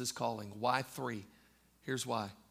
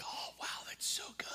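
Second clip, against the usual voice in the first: hushed, breathless whisper of wonder; awed whisper; 9 dB quieter than usual. Retold or imitated. Peter watching his friend touched by God, in a whisper. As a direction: whisper it like you can't believe it.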